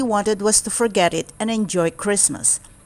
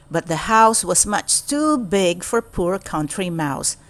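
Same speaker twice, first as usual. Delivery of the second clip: plain reading voice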